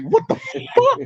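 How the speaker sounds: high-pitched, baffled squeal of disbelief; incredulous yelp, played for laughs; mock shock. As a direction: act out being floored, voice leaping way up. Retells his shock at the news.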